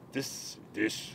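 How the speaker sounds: lightly voiced mentor impression, hushed and halting; half-hearted quiet sage imitation; Yoda. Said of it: "Yoda said to, uh, Luke Skywalker".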